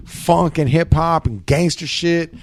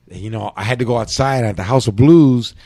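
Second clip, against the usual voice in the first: normal talking voice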